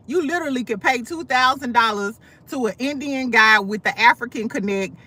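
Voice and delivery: usual speaking voice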